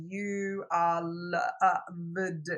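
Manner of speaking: very slow, sounded-out words; reading aloud haltingly. Retold or imitated. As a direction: read it like a child sounding out letters. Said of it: "she's sounding out the words".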